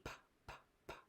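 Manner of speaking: "p" as a voiceless puff; teacher voicing the letter p; letter sound p. Shown as a demonstration. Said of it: "And then P".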